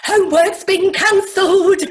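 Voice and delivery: high, loud sing-song teacher; Sue Pollard. Retold or imitated. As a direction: trill it like a giddy teacher. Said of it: "Dead ringer for Heidi High's Sue Pollard".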